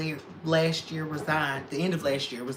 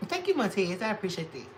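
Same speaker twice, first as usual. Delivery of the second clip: ordinary talk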